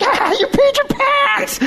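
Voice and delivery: put-on very high, drawn-out kid's taunt; jeering classmates. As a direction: keep mocking in a squealing child voice. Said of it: "everybody else was still like".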